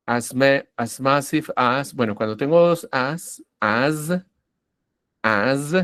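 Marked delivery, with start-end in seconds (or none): 3.6-5.7 "as" said with a buzzing final z; Z sonora final. Shown as a demonstration. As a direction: hold the voiced z ending on "as". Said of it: "me enfoco en hacer la Z". ("as" con una z sonora y vibrante al final)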